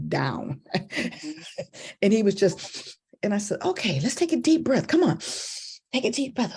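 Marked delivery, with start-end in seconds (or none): 3.6-6.5 put-on high, encouraging voice for a child; bright-pitched gentle urging; coaxing a child. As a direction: coach a little one through calming down.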